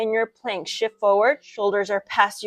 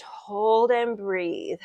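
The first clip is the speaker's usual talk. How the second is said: slow drawn-out cue; much slower than usual. Shown as a demonstration. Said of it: "yoga teacher cue".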